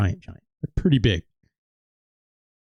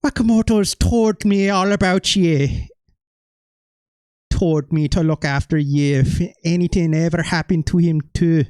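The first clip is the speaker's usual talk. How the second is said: higher, hammed-up Irish brogue; renewed Irish accent; 4 semitones higher than usual. Dynamite Kid promising to look after you, thick mock Irish accent. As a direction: lean harder into a put-on Irish accent. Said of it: "It doesn't sound very Irish anymore".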